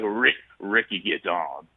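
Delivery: low, slow impression of Ricky; lightly mock Ricky voice; imitating Ricky. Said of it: "the same raspy voice".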